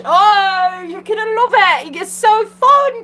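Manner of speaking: high, loud hype; excited. Real, not acted. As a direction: pump up the audience, high and loud. Hyping viewers up.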